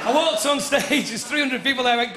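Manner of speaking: ordinary talk, nothing marked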